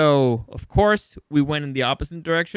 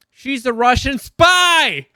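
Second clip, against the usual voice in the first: mock exclamation; 8 semitones higher, 6 dB louder, much slower than usual.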